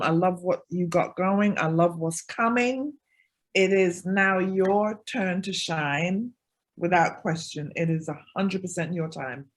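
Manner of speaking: ordinary delivery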